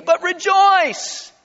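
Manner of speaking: high, loud, drawn-out rallying cry; exultant proclamation. Acted. Preacher urging believers to rejoice in trial, exultant. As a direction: proclaim it jubilantly, stretching the word.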